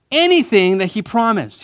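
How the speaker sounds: anything punched high to correct the misquote; stress on anything. Shown as a demonstration. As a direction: hit anything hard and high. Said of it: "No, no, no. He says".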